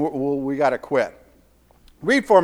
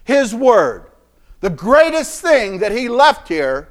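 climbing, loud sermon cry; full-force preaching; 7 semitones higher, 6 dB louder than usual. Acted. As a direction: build the congregation up to the peak.

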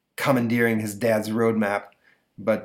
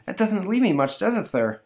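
put-on much higher kid voice; innocent boy; 10 semitones higher than usual. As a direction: answer dad with innocent cheek. Boy answers dad.

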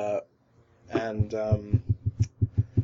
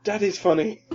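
mock rage; 8 semitones higher than usual. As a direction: snap back like an offended dad. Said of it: "his rage at that".

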